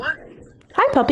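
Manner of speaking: high sweet voice for a puppy. Real, not acted. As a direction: coo at the dog. Greeting the dog.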